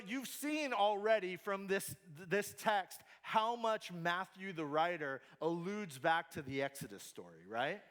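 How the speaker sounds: ordinary talk, nothing marked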